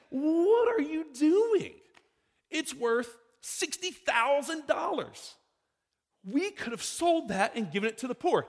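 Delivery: slow, high, loud outraged disbelief; indignant disciples. Acted. Pastor voicing the disciples scolding the woman, slow high indignation. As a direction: play the scandalised disciples, dragging out each word.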